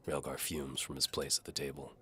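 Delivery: plain reading voice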